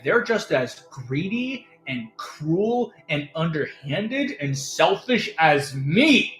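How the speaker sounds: a tyrant vowing to remake the world; villainous ruler. Pretend. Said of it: "and be like".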